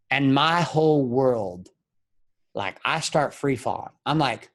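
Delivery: ordinary talk, nothing marked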